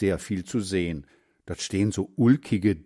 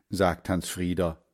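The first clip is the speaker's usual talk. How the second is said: usual speaking voice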